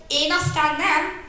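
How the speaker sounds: slow, loud indignant protest. Acted. Cheetah protests to the bear, indignant and slow. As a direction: huff it out as if insulted. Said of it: "méltatlankodott Gepárd Géza".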